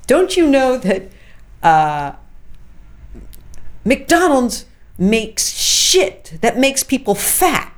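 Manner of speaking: loud, high-pitched mock tirade; indignant mock shouting, drawn out; retold furious screaming. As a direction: imitate an irate caller, punching out each word slowly. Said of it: "he called me screaming".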